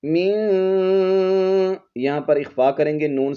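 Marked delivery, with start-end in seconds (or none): 0.0-1.8 slow, high melodic recital; Quranic recitation. Shown as a demonstration. Teacher reciting verse to students. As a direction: chant it out slowly.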